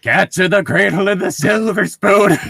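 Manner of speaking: high, loud cartoon yell; hammed-up sidekick cry; Donkey impression. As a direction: shout it like an excitable donkey.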